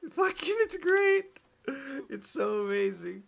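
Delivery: high, slow put-on sarcasm; sarcastic. Mocking flat earth reading for chat, high and slow sarcastic. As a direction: gush it out, dripping irony.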